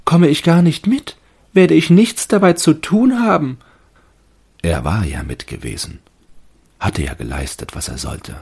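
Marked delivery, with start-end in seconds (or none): 0.0-3.6 high, loud, anxious little tree voice; fir tree thinking. A narrator's reading. Fir tree wonders if it takes part, high anxious voice. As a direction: fret about being left out. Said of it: "blieb ganz still und dachte".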